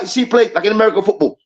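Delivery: normal talking voice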